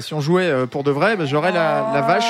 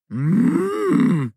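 cow moo; 6 semitones higher than usual. Pretend. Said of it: "on fait un concours de meux".

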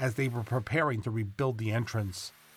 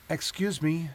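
put-on higher-pitched boy's voice; young boy; 6 semitones higher than usual. A narrator's reading. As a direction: pipe up politely to interrupt the adults. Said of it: "It was the boy".

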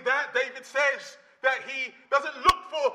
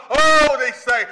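ordinary delivery

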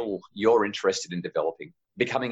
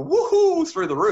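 mock rising emotion; 5 dB louder than usual. Mocking parents' emotions rising.

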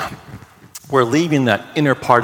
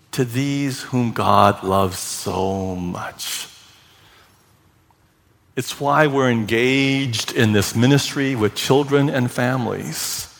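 usual speaking voice

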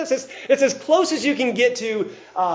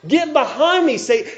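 higher, louder, forceful "Get behind me, Satan"; louder rebuke; 7 semitones higher, 6 dB louder than usual. Retold or imitated. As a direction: throw the rebuke out hard and high. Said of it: "He's calling Peter by that name".

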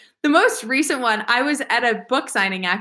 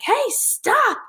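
high-pitched imitation of a woman's yell; mock yelling; 12 semitones higher than usual. Student imitating the lieutenant's wife stopping her, mock yell. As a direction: call out sharply high, without real volume. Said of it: "this woman started yelling at me".

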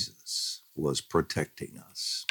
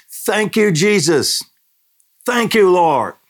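very high, loud praise cry; shouted thanks; 13 semitones higher, 6 dB louder than usual. Retold or imitated. Family shouting praise to Jesus, loud high cry. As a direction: shout thanks to God at death's door. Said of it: "we shouted".

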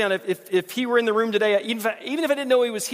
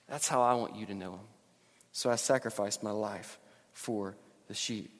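soft; 7 semitones lower, 7 dB quieter than usual. Acted. Soft reflective close of quote.

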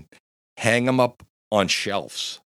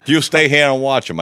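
louder, higher quoted command; bossy order; 6 semitones higher, 7 dB louder than usual. Retold or imitated. Friend ordering him to guard the drunk, bossy order. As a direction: act out giving a friend orders.

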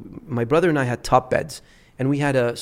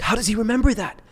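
high astonished question; amazed aunt; 9 semitones higher than usual. Retold or imitated. Aunt asking his mom, mock astonished and high. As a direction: imitate the stunned aunt. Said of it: "she's like".

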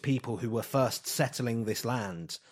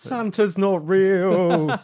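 mock childlike wail; 8 semitones higher, 9 dB louder, much slower than usual. Joking about childhood lies revealed.

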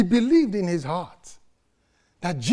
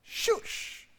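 hushing crowd; 11 dB quieter than usual. Retold or imitated. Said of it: "shouted him down".

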